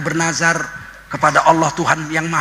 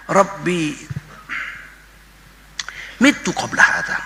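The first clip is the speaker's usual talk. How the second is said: Arabic recitation; 3 dB quieter, slower than usual. Acted. Mary's lament recited in Arabic.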